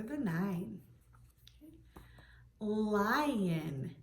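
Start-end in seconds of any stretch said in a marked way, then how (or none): 2.6-3.9 "Lion" with a long l and stretched I; drawn-out word. Shown as a demonstration. Teacher offering the next word to check, stretched "Lion". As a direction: draw "Lion" out slowly. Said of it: "Do you hear an I sound".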